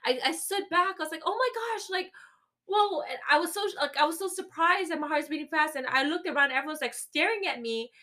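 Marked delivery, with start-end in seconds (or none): 1.2-3.0 much higher, breathless gasp of surprise; stunned, higher oh my gosh; shocked. Retold or imitated. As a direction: react like the bag just fell. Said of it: "so shocked".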